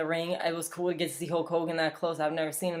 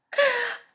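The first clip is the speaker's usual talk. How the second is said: high burst of laughter; 3 dB louder than usual. Real, not acted. Laughs at Cena's jab.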